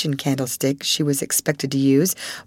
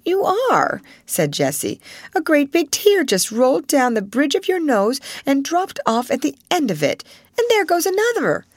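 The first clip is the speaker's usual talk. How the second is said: put-on higher, bright-eyed little girl pointing out a tear; teasing schoolgirl; 10 semitones higher than usual. A narrator's reading. Jessie teasing Ermengarde about her tears, high little-girl voice.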